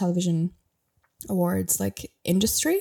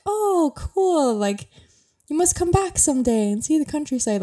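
forced polite enthusiasm; 9 semitones higher than usual. Retold or imitated. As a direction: gush politely while hiding disappointment. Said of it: "trying in a polite way to say".

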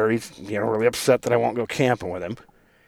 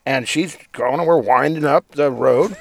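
ordinary delivery

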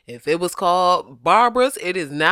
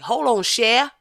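higher, attempted New Orleans accent; 6 semitones higher than usual. Guessing how they would say it, New Orleans accent. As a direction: try a New Orleans lilt, pitched up. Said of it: "that's the closest I got to New Orleans".

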